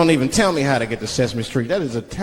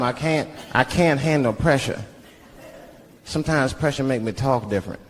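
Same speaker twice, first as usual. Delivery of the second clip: ordinary talk, nothing marked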